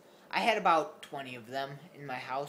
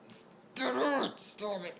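high scolding; 10 semitones higher, much slower than usual. Real, not acted. Telling the cat to stop, a high scolding voice. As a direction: scold the pet, high-pitched.

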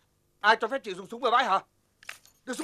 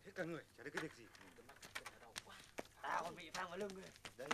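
grumbling mutter; 6 semitones lower, 25 dB quieter, faster than usual. Acted. Grumbling aside after the fight.

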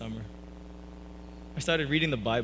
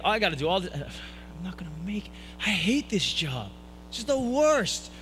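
bitter grumbling; 4 semitones higher than usual. Retold or imitated. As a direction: grumble to yourself, stretching the complaint. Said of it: "just angry and fuming".

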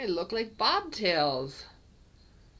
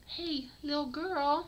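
high pet call; 10 semitones higher, 3 dB louder than usual. Real, not acted. Calling kitten to camera.

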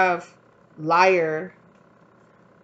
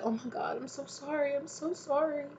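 higher, soft, breathy-free sympathetic coo; consoling friend; 8 semitones higher, 9 dB quieter than usual. Retold or imitated. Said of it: "it's like".

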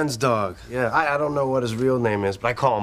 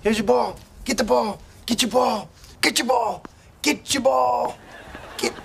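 high, eager fetch calls; desperate coaxing; 9 semitones higher than usual. Acted. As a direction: urge a listless dog to play. Desperately urging dog to fetch.

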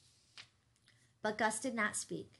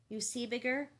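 higher-pitched, patient pride; kind but proud; 4 semitones higher, 3 dB louder than usual. A narrator's reading. Gus begins reasoning with Bigger, kind but proud. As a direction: reason with him kindly, keeping your pride. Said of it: "compromise between kindness and pride".

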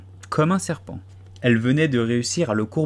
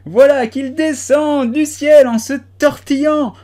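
very high, much louder, slow piercing cry; shrill shriek; 14 semitones higher, 8 dB louder, slower than usual. A narrator's reading. Pigeon shrieking at the snake, high piercing cry. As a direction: let it climb into a screech. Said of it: "la voix monta jusqu'à devenir un cri aigu".

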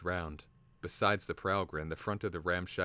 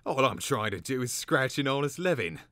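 high, loud aggrieved protest; complaining; 7 semitones higher, 8 dB louder than usual. A narrator's reading. Slaughterer gathering his spilt charms, complaining loudly. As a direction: protest it like a wronged trader. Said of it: "he complained".

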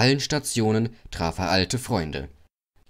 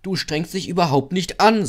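higher, insistent telling-off; raised, serious reprimand; stern scolding; 8 semitones higher, 4 dB louder than usual. A narrator's reading. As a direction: reproach him in earnest. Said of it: "sprachen in ernstem Ton".

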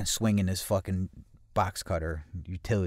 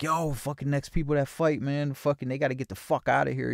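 raised pitch, riled-up retelling; agitated retold outburst; 6 semitones higher than usual. Teller replays his outburst at a party, agitated. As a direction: imitate yourself getting riled up.

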